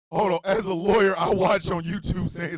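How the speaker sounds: normal talking voice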